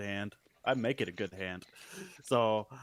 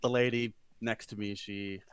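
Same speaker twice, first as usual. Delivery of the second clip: usual speaking voice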